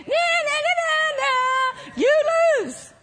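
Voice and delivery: high sing-song playground taunt; mocking. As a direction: taunt like a child who has won, far up in pitch. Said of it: "It says he mocks him".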